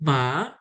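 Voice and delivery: ma rising; second tone. Shown as a demonstration. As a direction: pull ma upward like a question. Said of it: "Nada kedua".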